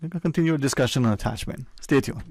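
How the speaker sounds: upbeat radio sign-off, fast; radio host outro. Real, not acted. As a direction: sell the break like a DJ. Radio host going to break.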